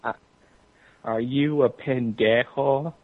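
ordinary talk, nothing marked

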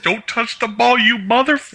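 retold yell at the TV. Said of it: "I'm yelling, like".

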